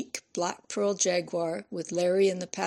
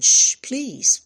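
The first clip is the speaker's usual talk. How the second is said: higher, louder Shh to cut him off; hushing; 5 semitones higher, 7 dB louder than usual. A narrator's reading. Senior nurse silencing Larry at the station, urgent shush.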